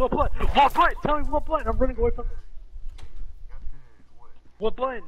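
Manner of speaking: high frantic demands; panicked. Real, not acted. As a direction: shout it while fleeing. Chased, demanding answers.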